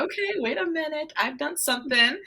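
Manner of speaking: high-pitched, acted dawning amazement; amazed realisation. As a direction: play it high, as if just realising it.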